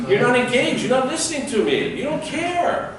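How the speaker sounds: high, put-out voice of someone ignored; whiny you're-not-listening complaint; mock hurt speaker. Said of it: "what's that telling them?".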